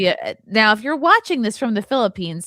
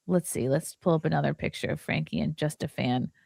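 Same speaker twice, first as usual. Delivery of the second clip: sly whispered aside; whispering; 5 semitones lower, 6 dB quieter than usual. Real, not acted. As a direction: whisper it like gossip. Sly aside about Frankie's fan.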